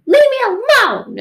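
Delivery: screamed high and loud; furious yell. Retold or imitated. Angry person yelling at someone they love. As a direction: scream it like a slammed door. Angry argument with loved one.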